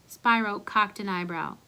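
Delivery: ordinary delivery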